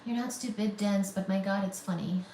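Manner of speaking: ordinary talk, nothing marked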